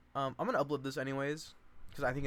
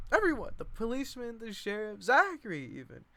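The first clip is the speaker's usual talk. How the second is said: much higher, breathless list; frantic listing; 10 semitones higher, 4 dB louder than usual. Acted. Lenny listing everyone blaming Babe, high and frantic. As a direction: pile the names up frantically.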